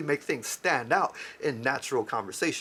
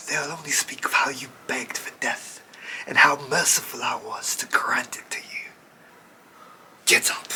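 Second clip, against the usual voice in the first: menacing whisper. Acted. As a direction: hiss the threat close. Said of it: "crept up on him like".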